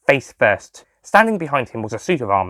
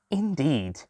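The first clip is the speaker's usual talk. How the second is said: calm; 4 semitones higher, 8 dB quieter than usual. Acted. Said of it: "the master said calmly".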